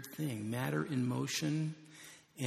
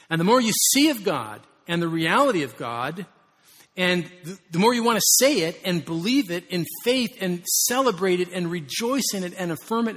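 building preaching fervor; 4 semitones higher, 10 dB louder than usual. Real, not acted. Pastor urging his congregation, rising fervent preaching.

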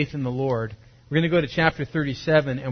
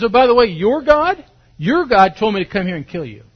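loud, high, gloating jab; high, loud taunting commander; mocking taunt; 4 semitones higher, 6 dB louder than usual. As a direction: rub it in with a loud, high mock. Commander's final mocking blow.

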